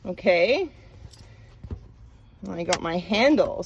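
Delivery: ordinary talk, nothing marked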